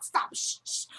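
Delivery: imitating an urgent shushing whisper; whispered hushing. Husband trying to silence the fake moaning, whispered shushing. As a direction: hush someone under the breath, retold.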